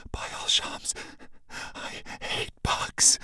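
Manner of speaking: slow frightened whisper; whispering in fear of the bug; scared whisper. Acted. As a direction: breathe it out in terror. Terrified whisper beside scorpion.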